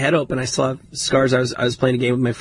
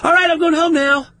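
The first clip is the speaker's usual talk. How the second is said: mock nonchalant; 14 semitones higher, 3 dB louder than usual. Playing it cool, bleeding.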